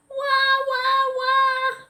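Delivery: high sing-song wah wah wah; child wailing. Acted. Girl upstairs screaming and sobbing. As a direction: wail it like a cross child. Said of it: "screaming and sobbing".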